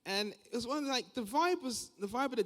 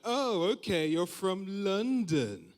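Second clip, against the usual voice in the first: loud, higher, drawn-out sarcastic okay; eye-rolling disbelief; 4 semitones higher, 5 dB louder, much slower than usual. Acted. People doubting where he is from, sarcastic. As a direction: lean slowly on it with mocking scepticism. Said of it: "roll their eyes and be like".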